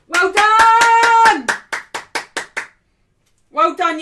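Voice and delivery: very loud, high joyful roar; goal celebration. Real, not acted. Fan roaring as United score. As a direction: belt it out after the goal.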